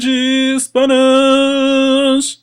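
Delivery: slow, high, loud channel name call; intro shout. Acted. Host shouting the channel name to open the video, loud and drawn out. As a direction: belt out the show name like a jingle.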